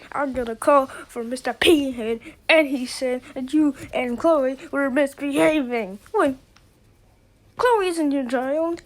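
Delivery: ordinary talk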